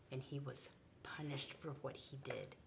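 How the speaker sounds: hushed whisper before a surprise; storyteller whispering before the reveal; teasing whisper. Acted. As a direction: whisper to build excitement. Whispered build-up for kids.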